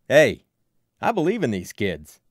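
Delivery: loud opening hail, then firm declaration; rallying call. A narrator's reading. Lou defending the kids to a crowd, loud and rallying.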